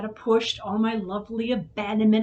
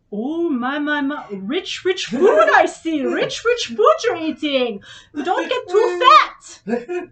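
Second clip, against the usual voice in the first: much higher, louder and very slow, sneering; mocking boyfriend; 6 semitones higher, 7 dB louder, slower than usual. Mimicking her boyfriend mocking her breakfast, sneering and slow. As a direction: drag it out like a smug critic. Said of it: "what does he say".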